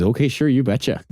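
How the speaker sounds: chirpy Midwestern bus driver; a light Minnesota twang; Minnesota accent. Retold or imitated. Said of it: "And the guy goes".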